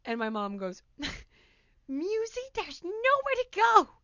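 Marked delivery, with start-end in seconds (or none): 1.9-3.9 high-pitched imitation of a frantic mother; frantic mom. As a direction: plead with the cornered cat, high and louder. Acting mom cornering the cat.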